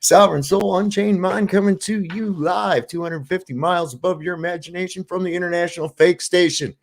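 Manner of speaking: loud, big live show welcome; showman announcer. Acted. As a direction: greet the crowd like a host.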